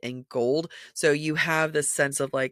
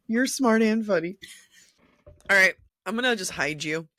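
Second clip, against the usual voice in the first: normal talking voice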